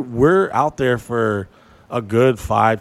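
usual speaking voice

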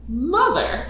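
high, drawn-out reproach; mock disappointed "Mother"; scolding "Mother". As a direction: chide the mother, half laughing. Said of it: "but it's like".

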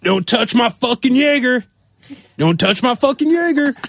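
high-pitched mock yell from another room; put-on high holler, no real force; imitated yelling. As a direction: imitate a guy yelling through the house. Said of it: "who's just yelling from the other room".

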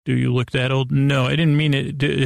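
normal talking voice

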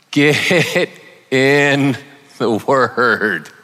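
slow, laughing "get in the word". Shown as a demonstration. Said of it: "one of my points is gonna be".

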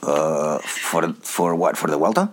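a hint of a hesitant Mike; slightly wrong copied voice. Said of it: "I'm gonna do Mike".

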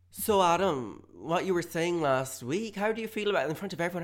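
high, loud public questioning; nosy friend. Acted. Friend quizzing him before the group, high and loud.

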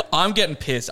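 chuckling through the words; laughing. Real, not acted. Laughs while retelling anger.

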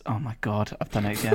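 ordinary talk, nothing marked

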